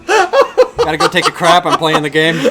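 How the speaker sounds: laughing. Real, not acted.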